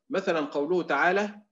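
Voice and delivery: usual speaking voice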